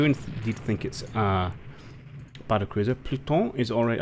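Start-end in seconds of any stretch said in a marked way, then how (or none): 3.0-3.5 French-accented ship name. Real, not acted. Naming the Spanish battle cruiser, French accent. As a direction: slip into a French pronunciation. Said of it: "put a French accent on".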